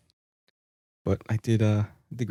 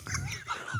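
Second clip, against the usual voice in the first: breathy laugh; 13 dB quieter than usual. Real, not acted. Reading absurd question.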